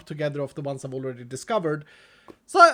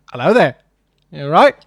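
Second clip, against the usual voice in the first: loud mock greeting; 15 dB louder than usual. Voicing an item looking at him.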